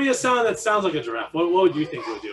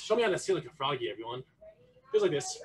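ordinary delivery

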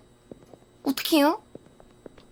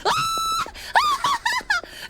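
very high shriek; shrieking scream. Real, not acted. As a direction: scream in fright. Screams at jump scare.

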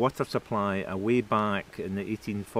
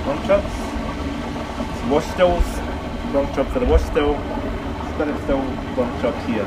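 speaking up over machine noise; louder and higher to carry over the noise; raised voice over machinery; 4 semitones higher, 4 dB louder than usual. Real, not acted. Touring noisy still house.

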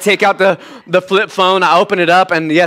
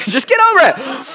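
high-pitched, laughing jab; teasing squeal of tough love; joking bluntness; 9 semitones higher than usual. Pretend. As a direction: toss it out playfully, voice jumping up. Joking repeat after mock apology.

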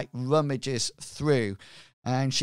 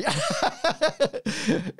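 real laugh breaking out; high burst of laughter; 11 semitones higher than usual. Real, not acted. Player eating nuts at table.

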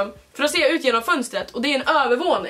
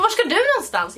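curious teasing; 9 semitones higher than usual. Retold or imitated. Girls question her.

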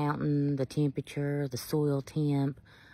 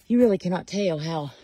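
ordinary talk, nothing marked

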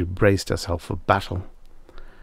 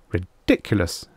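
ordinary delivery, nothing marked

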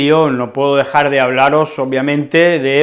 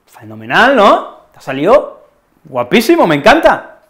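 elogio entusiasta; 5 semitones higher, 5 dB louder than usual. Acted. Elogia el dibujo del niño.

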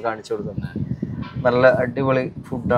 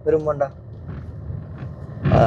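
normal talking voice

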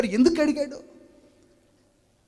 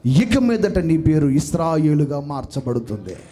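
loud low proclamation; 6 semitones lower, 5 dB louder than usual. Acted. God proclaims new name.

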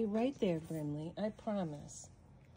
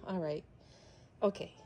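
normal talking voice

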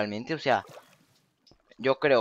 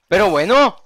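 exasperated shout; 8 semitones higher, 14 dB louder than usual. Real, not acted. Peak of exasperated outburst.

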